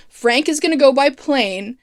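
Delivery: ordinary talk, nothing marked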